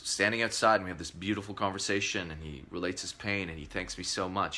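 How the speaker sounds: usual speaking voice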